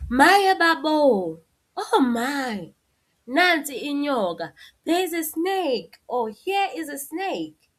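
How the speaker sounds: high, drawn-out alarmed exclamation; exclamation, exaggerated. Shown as a demonstration. Teacher showing an exclamation about a snake, high and alarmed. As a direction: throw it out like spotting a snake. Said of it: "if we want to put isibabazo".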